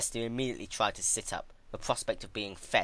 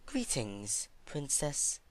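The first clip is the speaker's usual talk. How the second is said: softer, a hint of humble curtsy; meek greeting; 6 semitones higher, 5 dB quieter, much slower than usual. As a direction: greet your captor politely. Celestia greeting meekly.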